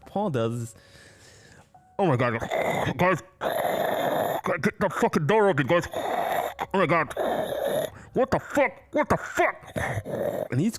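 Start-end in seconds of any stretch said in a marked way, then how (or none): 2.0-9.7 high, loud, frantic pleading with a cry in it; mock panic, begging friends to open the door; panicked freakout. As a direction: imitate a man trapped and terrified. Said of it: "he starts freaking out".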